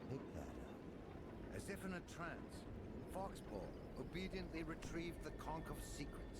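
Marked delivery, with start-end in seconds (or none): 0.1-1.6 slow, low hushed order; low whispered command. Acted. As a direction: whisper it with cold menace. God commanding gatekeeper.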